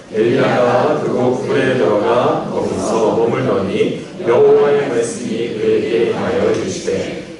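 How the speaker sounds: slow chanted unison reading; group scripture reading. Real, not acted. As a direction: read the verse together, slow and even. Congregation reads scripture in unison.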